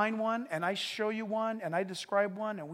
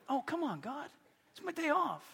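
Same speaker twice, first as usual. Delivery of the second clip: mock whine; 6 semitones higher, 4 dB quieter than usual. Whining to God.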